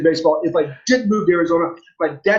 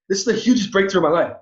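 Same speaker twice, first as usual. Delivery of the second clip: ordinary talk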